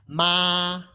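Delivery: "Ma" as a flat first tone; tone demo. Shown as a demonstration. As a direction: sustain "Ma" on one pitch. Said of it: "one more time".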